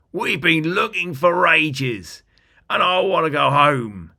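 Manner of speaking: a small goblin begging to go home; littlest goblin. Acted. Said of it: "said the littlest goblin".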